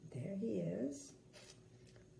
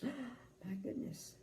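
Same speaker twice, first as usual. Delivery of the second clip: gasping shock; 2 semitones higher, 9 dB quieter, slower than usual. Acted. Reader reacts to Grumpy shoving.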